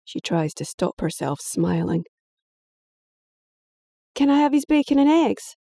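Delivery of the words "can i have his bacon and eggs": put-on high, bright kid's voice; girl angling for her brother's breakfast; cheeky young girl. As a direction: ask it greedily, much higher.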